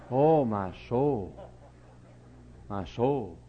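drawn out, dismayed; slow shocked sigh. Real, not acted. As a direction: stretch it, aghast. Preacher's shocked exclamation.

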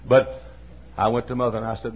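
normal talking voice

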